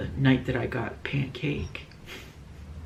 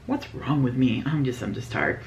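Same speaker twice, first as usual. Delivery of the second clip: ordinary delivery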